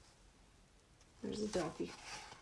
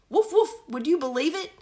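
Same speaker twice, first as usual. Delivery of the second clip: higher, louder barking dog voice; dog's voice; 7 semitones higher, 5 dB louder than usual. Acted. Dog telling the princess, higher and louder. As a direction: bark the words out. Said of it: "said the dog".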